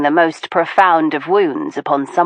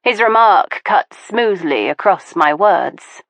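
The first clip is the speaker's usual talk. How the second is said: usual speaking voice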